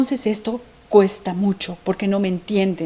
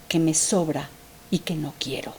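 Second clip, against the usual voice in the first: breathy hushed whisper; 8 semitones lower, 11 dB quieter than usual. Real, not acted. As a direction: drop to a whisper as if confiding. Intimate aside about unwanted grief.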